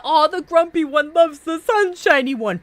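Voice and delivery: high, loud swooning gush; giddy fangirl squeal; gushing fan. Acted. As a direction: squeal over the ship like a fangirl.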